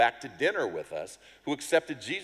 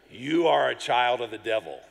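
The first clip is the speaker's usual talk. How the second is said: stern rebuke; 6 dB louder than usual. Acted. Paul rebukes Elymas, quoted.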